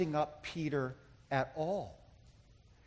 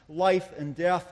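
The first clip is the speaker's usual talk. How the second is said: ordinary delivery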